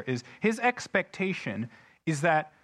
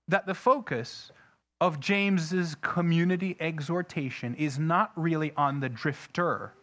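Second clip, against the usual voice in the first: ordinary delivery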